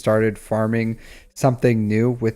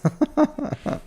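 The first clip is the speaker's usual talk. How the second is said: high, soft giggling laugh; high laughter; 5 dB quieter than usual. Real, not acted. Streamer teasing Sopper, high amused laugh. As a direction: laugh at your own teasing. Laughing at own mimicry of viewer.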